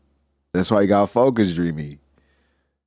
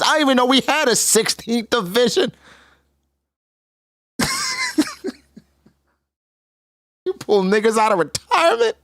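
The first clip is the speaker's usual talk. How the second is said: much higher mock rant; mock outraged pirate; 14 semitones higher than usual. Whitebeard snapping back at Thatch, high mock rant. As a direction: rant up high like a cartoon captain. Voicing Whitebeard's angry retort.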